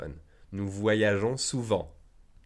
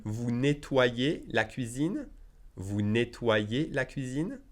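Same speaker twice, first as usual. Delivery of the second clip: slow, clear teacher model sentence; deliberate classroom pace; slow model reading; 2 semitones higher, slower than usual. Shown as a demonstration. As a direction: say it slowly for students to repeat. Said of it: "teacher model sentence, slowed".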